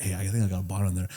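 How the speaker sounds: sneaky hush. Retold or imitated. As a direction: keep it low so nobody in the room hears. Sneaking into the room.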